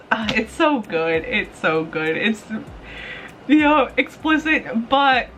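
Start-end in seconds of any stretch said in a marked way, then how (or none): none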